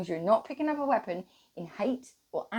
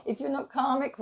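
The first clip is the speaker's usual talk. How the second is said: normal talking voice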